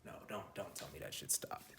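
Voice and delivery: real low, very soft aside; quiet dismay. Real, not acted. Speaker suspects the camera stopped, quiet dismay. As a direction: say it low to yourself.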